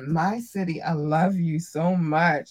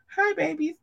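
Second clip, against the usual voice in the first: squeaky cute voice; 13 semitones higher than usual. Acted. Cutesy greeting.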